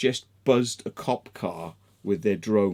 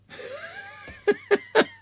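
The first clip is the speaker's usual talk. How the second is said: high burst of helpless laughing; high cackling laugh; real laughter. Real, not acted. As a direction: crack up at your own story. Laughing at police car punchline.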